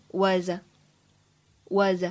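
"was" run into the article a, as "wuz-uh"; slow "was", gliding straight into a schwa; linked words. Shown as a demonstration. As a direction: link the z of "was" onto a following "uh". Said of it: "会有一个连读的处理".

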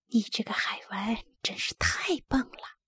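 soft, near-whispered praise; hushed voice. A narrator's reading. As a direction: keep it hushed, as if hiding from hunters. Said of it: "康妮小声说". (压低声音，小声地说)

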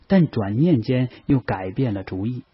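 usual speaking voice

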